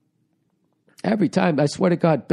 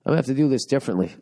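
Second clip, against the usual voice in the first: normal talking voice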